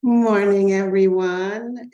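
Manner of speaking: big, bright hello to the room; loud greeting. Real, not acted. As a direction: call out the greeting warmly. Greeting the festival audience.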